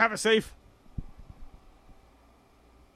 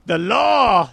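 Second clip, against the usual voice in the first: catchphrase shout; 4 semitones higher, 9 dB louder than usual. Retold or imitated. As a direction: belt out the catchphrase loudly.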